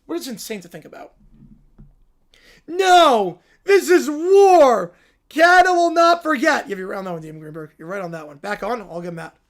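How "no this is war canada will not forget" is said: put-on louder, higher tearful toddler battle yell; furious war cry. Caillou vowing revenge after the loss, furious cry. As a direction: declare war like a wailing kid.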